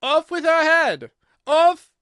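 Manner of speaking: loud, high drawn-out royal shriek; enraged queen screaming a death sentence; furious scream. A narrator's reading. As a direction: scream the order in crimson fury.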